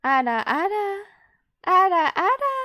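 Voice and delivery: loud, high-pitched "ara, ara"; defiantly high "ara, ara". Shown as a demonstration. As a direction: push "ara, ara" up high, rising at the end. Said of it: "Yeah, I'm going higher".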